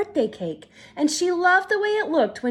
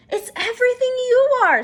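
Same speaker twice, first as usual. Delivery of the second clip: high, loud and fast reassurance; eager reassurance; 11 semitones higher, 6 dB louder, faster than usual. Acted. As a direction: insist lovingly. Mother reassures daughter.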